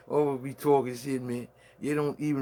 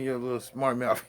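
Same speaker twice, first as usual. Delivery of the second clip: ordinary talk, nothing marked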